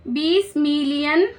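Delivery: बीस million said slowly, stretching बीस with a rising end; slow emphatic repeat. Shown as a demonstration.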